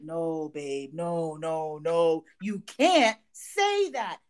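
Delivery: low, slow no babe, no; husband gently but firmly telling his wife no; husband's voice. Retold or imitated. Husband voiced calming her.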